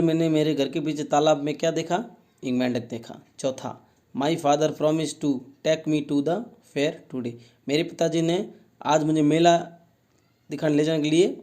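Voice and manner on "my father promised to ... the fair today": slow reading aloud. Shown as a demonstration. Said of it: "teacher reads sentence slowly".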